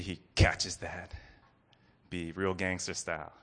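ordinary talk

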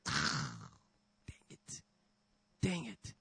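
soft, half-whispered dang it; muttered reluctant giving in; grudging frustration. Acted. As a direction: grumble it under your breath, defeated. Grudging sigh at God's nudge.

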